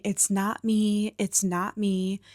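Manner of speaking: ordinary talk, nothing marked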